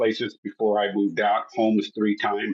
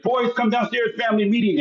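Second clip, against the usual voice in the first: much higher call up the stairs; 10 semitones higher than usual. Retold or imitated. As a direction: sister calling the boys down, high mock call. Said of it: "my sister screams upstairs".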